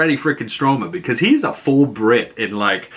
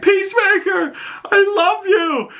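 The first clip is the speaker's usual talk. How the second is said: put-on little kid. Little kid yelling to a hero from a car, high mock shriek. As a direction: mimic an adoring child calling out. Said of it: "this little kid rolls down his window".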